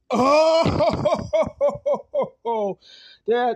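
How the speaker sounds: loud, high drawn-out "ohh"; scandalized "ohhh!" at a twist; shocked "oh". Real, not acted. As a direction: let out a big high whoop of shock. Mock-shocked reaction to plot twist.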